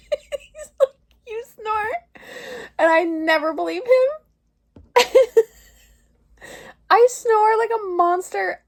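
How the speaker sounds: high, breaking with laughter; embarrassed laughing, voice high; laugh-crying. Real, not acted. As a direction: laugh till it nearly becomes crying. Laugh-crying at snoring recording.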